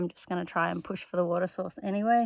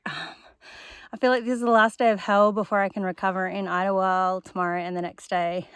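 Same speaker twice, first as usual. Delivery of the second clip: usual speaking voice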